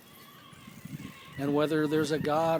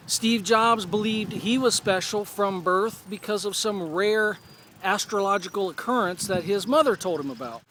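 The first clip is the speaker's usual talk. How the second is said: usual speaking voice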